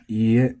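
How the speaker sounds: ie with even flat pitch. Shown as a demonstration. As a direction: say ie flat, no accent. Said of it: "the way it's gonna be taught is probably flat".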